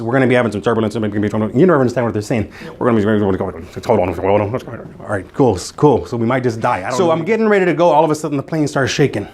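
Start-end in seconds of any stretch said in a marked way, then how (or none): 0.0-7.1 imitating the pilot. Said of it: "the pilot says".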